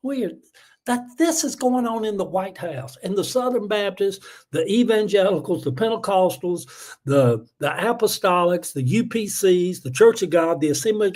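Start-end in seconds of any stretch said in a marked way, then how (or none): none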